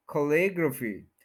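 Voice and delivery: कैलीग्राफी as kuh-LIG-ruh-fee; native pronunciation. Shown as a demonstration. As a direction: stress LIG and reduce the vowels in कैलीग्राफी. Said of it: "native pronunciation".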